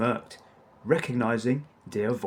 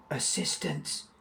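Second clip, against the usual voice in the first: soft, sharp hiss of a correction; 3 semitones higher, 8 dB quieter than usual. Acted.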